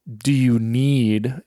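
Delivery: slow, leaning hard on need; Sarah's teasing stress on need. Retold or imitated. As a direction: press the word need like a pointed joke. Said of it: "emphasis on the word need".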